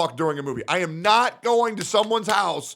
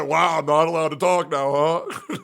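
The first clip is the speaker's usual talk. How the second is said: chuckling through the words; sarcastic reply with a laugh; laughing retort. Retold or imitated. Retelling own reply.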